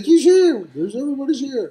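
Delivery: high loud hype; excited yell. Retold or imitated. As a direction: announce it like a hype man. Friend hyping the arrival.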